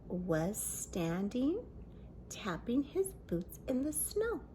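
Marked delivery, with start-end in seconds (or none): none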